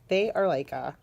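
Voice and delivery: ordinary delivery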